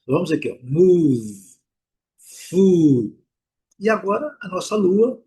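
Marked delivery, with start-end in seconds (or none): 0.6-3.2 food said fud with a long U, a bit louder. Shown as a demonstration. Teacher drilling the long U in food, a bit louder. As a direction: food with a drawn-out U sound. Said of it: "Um U bem longo".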